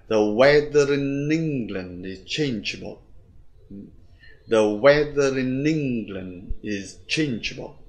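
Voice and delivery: slow beats on "weather", "England", "changeable"; measured three-stress demo, "weather" and "England" drawn out; three-beat rhythm. Shown as a demonstration. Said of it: "Đấy là ba trọng âm".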